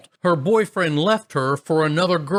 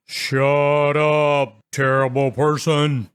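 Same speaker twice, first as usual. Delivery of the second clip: a hint of a deep menacing voice, lightly done; lightly faked monster growl; mock low scary voice; slower than usual. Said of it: "making my voice as low as possible".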